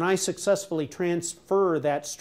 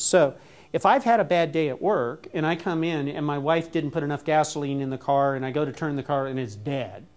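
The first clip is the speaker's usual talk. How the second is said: ordinary delivery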